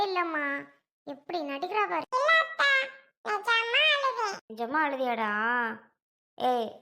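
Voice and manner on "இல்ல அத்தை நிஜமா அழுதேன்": put-on high, protesting little child voice; insistent small child. Child insisting to aunt the crying was real, insistent small child.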